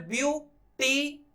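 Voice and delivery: ब्यू then टी, separate beats; ब्यू... टी, slowly apart; syllables split. Shown as a demonstration. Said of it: "फिर टी बोलना है".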